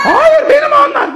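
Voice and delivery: high-pitched protesting shout; bağırma. Real, not acted. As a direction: shout it out high and drawn-out, claiming what is yours. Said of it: "Ay nasıl bağırıyor?". (tiz sesle bağırarak itiraz)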